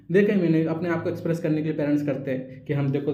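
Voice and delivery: normal talking voice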